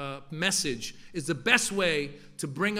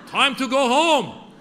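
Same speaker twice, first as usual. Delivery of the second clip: put-on loud, slow, high call in a thick Persian accent; 8 semitones higher, 9 dB louder, slower than usual. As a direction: call the son across the park in a heavy Persian accent. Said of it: "in his thick Persian accent".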